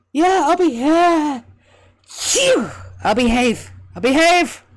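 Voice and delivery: high, loud breathless promise; sniffly promise. Acted. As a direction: gasp out the promise through snot.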